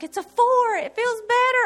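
high, loud excited burst; retelling a thrilled moment of relief. Retold or imitated. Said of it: "I was so excited about a four".

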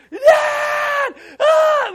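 very high, loud panicked scream; imitated screaming child. Father imitates son who thinks he's left, panicked scream. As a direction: scream for a parent like a frightened child. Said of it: "He's screaming, he's crying".

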